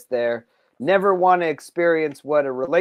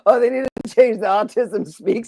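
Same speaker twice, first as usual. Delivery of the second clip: laughing; 5 semitones higher, 5 dB louder than usual. Real, not acted. Laughing at his own joke.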